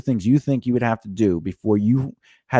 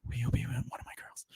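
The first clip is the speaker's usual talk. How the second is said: quick, soft whispered proposal; whispered plea; 18 dB quieter, faster than usual. Acted. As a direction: slip it out under your breath. Same skit, secret plea.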